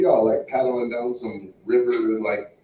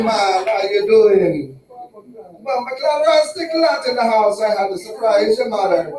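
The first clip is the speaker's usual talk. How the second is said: loud, high put-on Jamaican accent; hammed-up Jamaican patois, pitched up; 5 semitones higher, 5 dB louder, slower than usual. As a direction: greet the crowd in a big Jamaican accent. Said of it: "I realized that the Jamaican accent".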